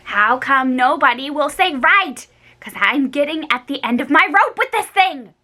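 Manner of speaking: exasperated whiny demand; fed up. A narrator's reading. Girl shaking Grace, fed up and whiny. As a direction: at the end of her rope.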